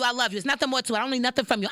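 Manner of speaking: normal talking voice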